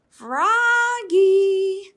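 high, loud sung call; loud singsong call. A narrator's reading. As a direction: sing his name across the snow. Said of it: "called his mother".

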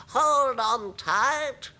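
slow, high, loud warning cry; drawn-out high creature yell; grasshopper's excited shout. A narrator's reading. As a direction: call out like a thrilled grasshopper. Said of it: "shouted the old green grasshopper".

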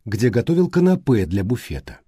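ordinary delivery

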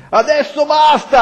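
high-pitched cry cutting in; mild acted yell, pitch leaping up. A narrator's reading. A lone voice silencing the crowd, crying out. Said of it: "gridò una voce fra tante".

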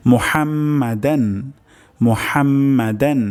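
মুহাম্মাদান with হা from mid-throat, slow, rising. Shown as a demonstration. Teacher shows students throat ha, slow and careful. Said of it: "হা উচ্চারণ হবে গলার মাঝখান থেকে".

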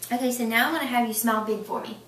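ordinary delivery

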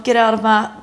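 ordinary delivery

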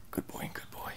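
whispered praise to a dog; whispering. Real, not acted.

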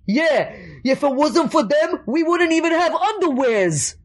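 high, hammed-up New York neighbor; crazy neighbor. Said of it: "crazy neighbor that kinda talks like that".

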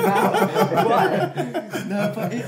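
high, loud laughing. Real, not acted. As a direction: laugh through it, pitch up. Laughing at his own answer.

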